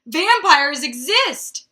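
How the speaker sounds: loud, high, drawn-out mock scream; screaming headline. A narrator's reading. Reader voicing the headline from the book, mock screaming. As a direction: belt it out slowly like a tabloid headline. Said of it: "the headline screamed".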